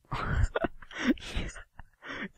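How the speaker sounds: high, loud burst of laughter; sudden loud laughing fit. Real, not acted. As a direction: burst out in a high-pitched cackle. Bursts out at the game.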